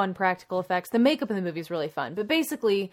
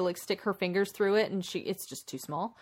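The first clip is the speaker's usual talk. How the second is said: ordinary delivery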